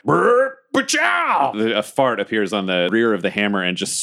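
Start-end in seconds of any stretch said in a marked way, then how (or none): 0.0-1.6 high, sharp zap-and-boom noise; blaster sound effect. Retold or imitated. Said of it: "I'm just like".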